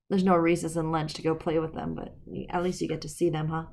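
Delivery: plain reading voice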